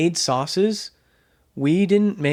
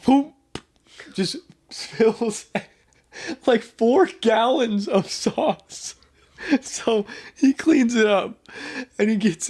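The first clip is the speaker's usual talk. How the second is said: laughing; 7 semitones higher, slower than usual. Real, not acted. Laughing while retelling spill.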